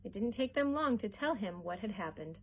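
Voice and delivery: ordinary delivery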